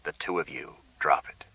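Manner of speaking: low, soft, clenched command; low, tight order. Acted. Sharkey tells the two women to drop it, low and tight. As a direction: shut them both down, low and tight. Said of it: "Sharkey's voice was low and tight".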